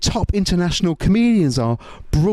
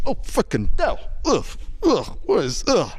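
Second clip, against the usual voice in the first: higher-pitched groans of mock disgust; disgusted reaction; 3 semitones higher than usual. Diner biting into a fricandel, comically disgusted. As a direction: recoil in short, falling ughs. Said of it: "you bite into it and you just go".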